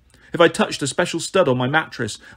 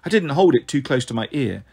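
ordinary delivery, nothing marked

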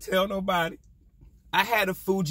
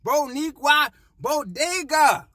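mock Spanish flourish; 7 semitones higher, 4 dB louder, slower than usual.

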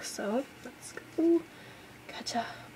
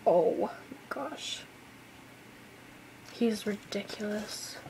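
usual speaking voice

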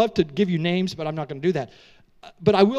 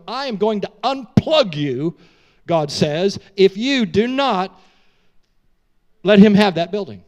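forceful warning; 8 dB louder than usual. Retold or imitated. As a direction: thunder the warning. God's warning through prophet.

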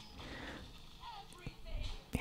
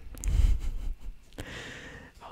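whispered laugh; 3 dB louder than usual. Real, not acted. Whispered laugh after winning.

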